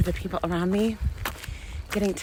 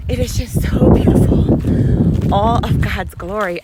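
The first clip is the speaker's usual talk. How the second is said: breathy, choked-up rush of feeling; emotional; 5 semitones higher, 10 dB louder, slower than usual. Real, not acted. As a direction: overcome by the beauty, voice catching. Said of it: "I'm already so emotional".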